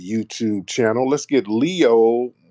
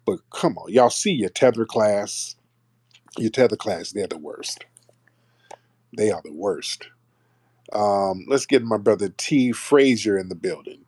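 ordinary talk, nothing marked

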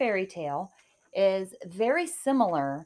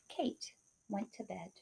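ordinary delivery, nothing marked

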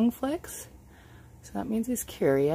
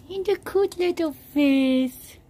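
gushing over pet; 9 semitones higher, 4 dB louder, slower than usual. Real, not acted. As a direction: coo adoringly at a cute pet. Gushes over pet's face.

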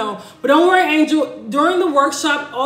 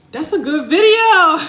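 laughing louder through the words; 4 semitones higher, 6 dB louder than usual. Real, not acted. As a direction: crack up while saying it. Laughing at viewer's joke.

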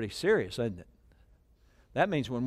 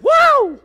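sudden, much higher, loud whoop; 14 dB louder than usual. Real, not acted. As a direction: let out a high joyful whoop. Preacher's own exclamation.

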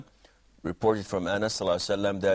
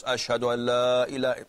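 raised, sustained adhan-style call; loud call. Shown as a demonstration. Teacher showing the loud repeat of the shahada, called out. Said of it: "in a loud voice".

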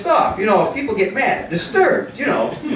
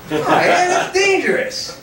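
mock innocent; 10 semitones higher than usual. Feigns innocence to the security guards.

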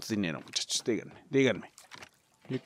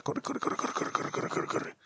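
soft rapid whispered repeats; urgent whisper; 11 dB quieter than usual. Real, not acted. Gamer sneaking past guards, whispering to himself to run. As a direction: hurry yourself on under your breath.

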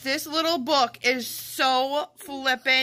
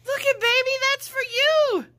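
excited cooing; 9 semitones higher than usual. Real, not acted. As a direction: coo it up high, delighted. Showing the doll its gift.

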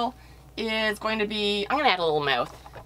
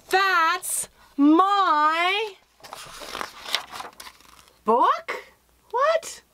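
high, squeaky disbelief climbing up; shocked surprise; 10 semitones higher, much slower than usual. Acted. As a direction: gasp it out as you catch the thief. Spencer finds the thief.

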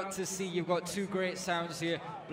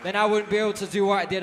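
loud, heartfelt thanks; passionate; 4 semitones higher, 8 dB louder than usual. Real, not acted. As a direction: pour your heart into it. Thanking crowd from his heart.

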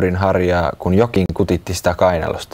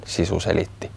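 usual speaking voice

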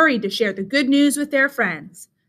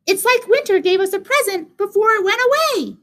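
very high little mouse squeak; teeny high-pitched mouse voice; tiny mouse; 9 semitones higher than usual. A narrator's reading.

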